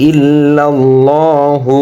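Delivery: "ইল্লাল্লাহু" with long, rounded "llaw"; one-alif elongation. Shown as a demonstration. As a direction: chant "ইল্লাল্লাহু", holding the heavy vowel. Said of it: "এক আলিফ টান হবে".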